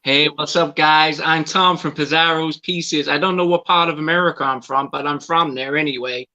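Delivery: a bright over-the-top American greeting, playing it for laughs; American accent. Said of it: "do your best American accent".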